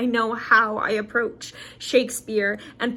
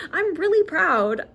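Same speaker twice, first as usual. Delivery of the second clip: emotional pride; 6 semitones higher than usual. Real, not acted. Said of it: "got emotional thinking about it".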